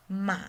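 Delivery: MA dipping low, then rising; third tone. Shown as a demonstration. Teacher demonstrates the third tone, falling then rising. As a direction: let "ma" fall first, then rise. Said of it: "the third one".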